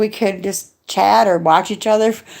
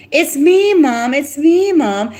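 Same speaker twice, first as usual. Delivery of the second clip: put-on high, pleading child voice calling Mom; daughter's voice; 7 semitones higher, slower than usual. Retelling the morphed voice claiming to be her daughter, high and pleading. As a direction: mimic a daughter's high plea. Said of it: "And they'd voice morph her".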